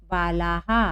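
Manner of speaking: "बालाहा" with the visarga sounded as a full हा; teacher sounding out "बालाहा", echoing the long आ after ह; visarga demonstration. Shown as a demonstration. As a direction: end "बालाहा" on a clearly voiced हा. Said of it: "यहाँ पर हम पढ़ेंगे इसको".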